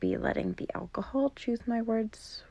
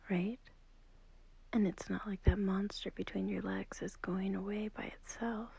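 hushed whisper, much softer; 5 dB quieter than usual. Acted. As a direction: whisper so the sleeper beside you doesn't wake. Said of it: "I'm whispering because I don't want to wake up".

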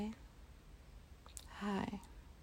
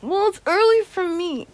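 high whiny protest; whiny, high and loud; 9 semitones higher, 10 dB louder than usual. Acted. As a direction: whine it playfully. Playful defensive retort.

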